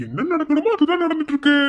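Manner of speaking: high, loud triumphant reply; triumphant declaration; gloating. Acted. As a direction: crow it over her.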